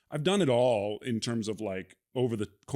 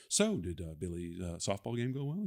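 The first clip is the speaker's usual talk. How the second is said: murmured small talk as a restaurant extra, softer; mock background diner; 3 semitones lower, 5 dB quieter than usual. Actor demoing loop group chatter for the host, hushed background extra. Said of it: "be like".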